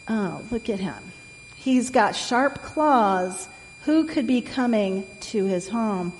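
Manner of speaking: plain reading voice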